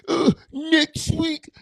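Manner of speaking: high, slow lisping repeat; lisping mock. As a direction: redo the phrase with a put-on lisp, drawn out. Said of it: "I sounded like I had a lisp".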